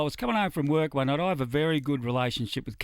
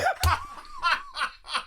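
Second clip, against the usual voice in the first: high laugh. Real, not acted. Laughing at Bradley Cooper reveal.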